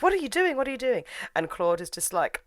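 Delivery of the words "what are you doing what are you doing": high-pitched acted alarm; mock alarm. Marion catches Claude with pills, high mock alarm. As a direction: imitate someone alarmed, pitched well up. Retold friend finding suicide attempt.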